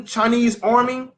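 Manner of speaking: usual speaking voice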